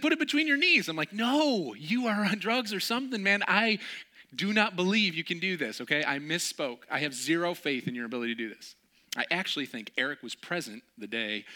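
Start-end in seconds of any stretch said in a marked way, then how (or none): 0.0-0.9 high, loud eager asking; eager question. Retold or imitated. Friend eagerly asking to shoot, high and louder. 1.1-8.7 louder emphatic no; firm refusal. Retold or imitated. Refusing the friend's dare, loud and emphatic.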